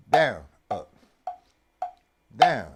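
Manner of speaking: Down and down barked loud; called-out strokes. Shown as a demonstration. Drum teacher calling the sticking aloud. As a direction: call the strokes, punching each down. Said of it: "That's the basic paradiddle".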